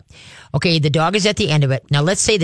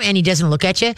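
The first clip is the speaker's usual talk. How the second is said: normal talking voice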